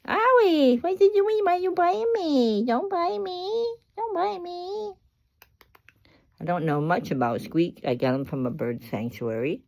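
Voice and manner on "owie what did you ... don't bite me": high pet talk. Real, not acted. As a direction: plead with a nipping bird, very high. Bird bites her while playing.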